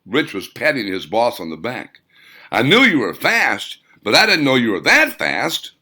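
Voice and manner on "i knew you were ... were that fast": louder, higher ribbing; teasing. Acted. Rich teasing his boss about his new date, loud. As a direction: rib your boss with a hearty jab.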